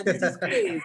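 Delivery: movie Italian accent, laughing; hammed-up Italian accent. Shown as a demonstration. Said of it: "can you say that again".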